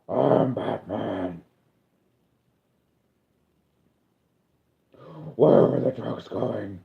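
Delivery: low, slow gravelly Batman growl. Retold or imitated. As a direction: drag it out low like Bale's Batman.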